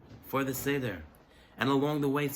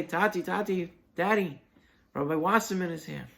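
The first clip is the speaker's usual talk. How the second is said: excited calling; 6 semitones higher, 5 dB louder than usual. Retold or imitated. Daughters calling their father.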